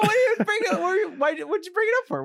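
high squeal of laughing disbelief; laughing outrage. Real, not acted. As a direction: shriek it out while laughing. Laughing mock outrage at friend.

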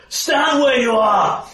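high, drawn-out shouted order; commanding yell. Acted. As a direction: halt a whole crowd with one long yell. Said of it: "yell as loudly as I can to them".

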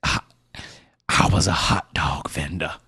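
breathless, whispered dying man; gasping whisper of an old man. A narrator's reading. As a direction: push it out on failing breath. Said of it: "he went on breathlessly".

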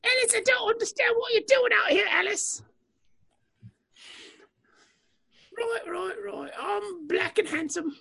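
high, lightly put-on British voice; a puzzled TV detective; mock British. Imitating the detective for friends, light mock British.

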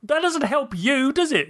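high-pitched baffled protest; mock disbelief. Player whose normal strategy fails, high mock bafflement. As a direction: question it in squeaky mock dismay.

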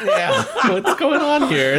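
squeaky high mock alarm; mock shocked squeal. Friend echoes the shocked reaction in a squeaky laughing squeal. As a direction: shriek it in fake shock, laughing.

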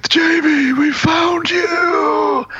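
high, drawn-out mock cry; calling out. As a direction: imitate a far-carrying call, stretching it out high. Voicing rescuers calling from police car.

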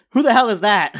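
suspicious guard. Acted. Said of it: "he just goes".

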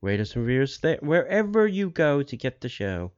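ordinary talk

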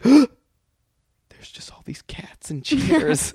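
slow, awed whisper. Retold or imitated. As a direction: whisper it like a spooky secret. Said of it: "And then it's like".

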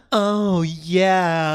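drawn-out, self-satisfied mock moan; proud sexy moan. Neighbour showing off how sexy she is, mock moaning. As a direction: stretch it out, showing off. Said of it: "she's proud of how sexy she is".

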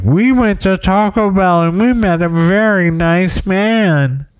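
high, sweet imitation of his kids gushing; kids' voice. Kids telling their mom about the nice man, in a high imitation. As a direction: play the kids, high and wide-eyed.

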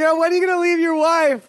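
high, pleading mistress; whiny mistress voice. Retold or imitated. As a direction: play the nagging mistress.

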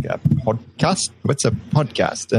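slow, puzzled, rising "A podcast?"; imitating a confused stranger on the street; baffled passerby. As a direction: play someone who has never heard the word. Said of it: "some of them are like".

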